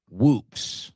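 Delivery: ordinary delivery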